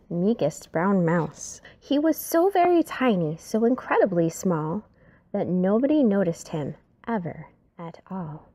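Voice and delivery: usual speaking voice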